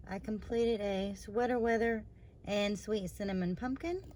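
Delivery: ordinary talk, nothing marked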